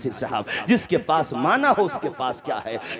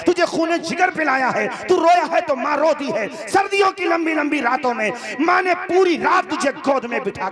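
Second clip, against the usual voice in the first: high, ringing sermon cry; fiery preaching; 6 semitones higher than usual. Acted. Preacher cries out a mother's sacrifices to the crowd in full fervour.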